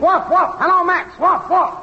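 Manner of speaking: high, rhythmic woofing chant; dog-talk mic test. Acted. Technician woofing at the mic for Max, high dog talk. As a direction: woof it high, like a human test signal. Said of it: "the dog talk".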